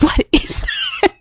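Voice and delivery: high, slow imitation of baffled onlookers; drawn-out mock disbelief, very high; mock bewilderment. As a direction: squeal it slowly, imitating puzzled bystanders. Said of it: "the guys looking around like".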